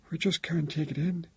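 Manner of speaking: high-pitched, stunned grief; very shaken. A narrator's reading. Bereaved father telling son-in-law of shock, very shaken. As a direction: let disbelief lift the voice. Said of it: "sounding very shaken".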